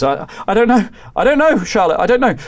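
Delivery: a tech at a loss; helpless panic. Acted. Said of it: "another tech goes".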